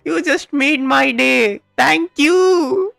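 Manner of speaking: usual speaking voice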